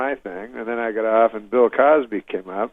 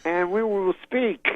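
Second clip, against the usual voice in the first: high, slow, rambling pronouncement; drawn-out comic mimicry; Bill Cosby; 9 semitones higher than usual. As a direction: ramble on in stretched, halting phrases, pitched high. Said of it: "then he says".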